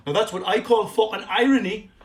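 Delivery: loud, high-pitched put-on rant; mock ranting character. Comic sending up Mike Ashley's backpedaling, in a loud, high put-on voice. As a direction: crank it up into a hammed-up comic tirade.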